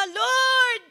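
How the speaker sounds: crying reproach to the Lord; tearful complaint. Acted. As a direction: sob out the complaint like a hurt child. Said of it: "Umiiyak siya kasi sama ng loob".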